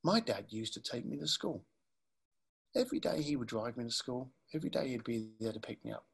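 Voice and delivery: quiet, sincere retelling as the caller; different person. Retold or imitated. Caller opening up about his dad, soft and low. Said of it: "came back to me as a different person".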